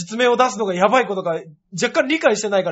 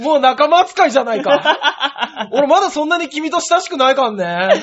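loud, high, cracking up; loud indignant retort while laughing; laughing protest; 7 semitones higher, 7 dB louder, slower than usual. Real, not acted. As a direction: protest helplessly through laughter.

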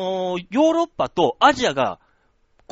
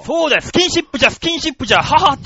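put-on high, loud old sage with a chuckle; creaky wise old man; 7 semitones higher, 6 dB louder than usual. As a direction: play a cackling old sage.

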